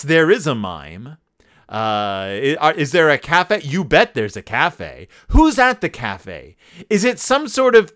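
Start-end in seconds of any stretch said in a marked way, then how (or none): none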